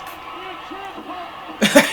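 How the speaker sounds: sudden high burst of laughter. Real, not acted. Big laugh at ref kick.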